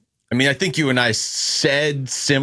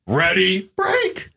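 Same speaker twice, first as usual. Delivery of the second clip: voice cracking at end; 6 semitones higher, much slower than usual. Acted. Co-host jokes the quarterback cracks at the very end. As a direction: jump to a squeak on "break". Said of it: "but at the end he goes".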